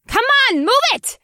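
high, loud impatient yell; exasperated high-pitched shout. Acted. Impatient shouting at slow crowd.

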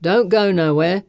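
loud, taunting villain call. Acted. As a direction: call it back mockingly. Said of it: "he calls".

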